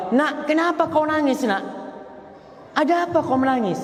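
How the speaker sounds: high, anxious motherly voice asking gently; high worried mother's voice. Retold or imitated. Parents ask crying daughter.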